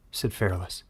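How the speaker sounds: ordinary delivery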